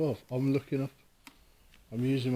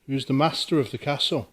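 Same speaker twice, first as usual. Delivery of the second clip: calling out; 4 dB louder than usual. Real, not acted. Calling out to a spirit.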